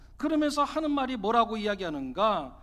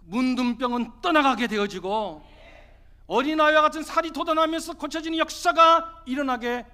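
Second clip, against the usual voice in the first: forceful preaching; 4 semitones higher, 7 dB louder than usual. Real, not acted. Preacher urging the congregation to obey without understanding, forceful preaching. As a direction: drive each phrase home at full pulpit force.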